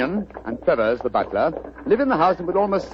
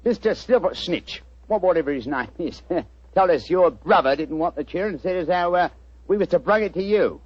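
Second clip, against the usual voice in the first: chirpy cockney delivery pitched up; cockney disguise. Acted. Disguised detective greets the homeowner in cockney. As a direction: play a cheeky London workman. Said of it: "What barbaric jargon do you speak?".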